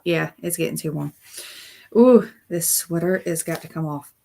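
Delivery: ordinary delivery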